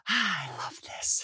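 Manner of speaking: slow, hushed thrill; delighted whisper. Retold or imitated. Mom thrilled by screaming kids, whispers with delight. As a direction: whisper it like a secret joy.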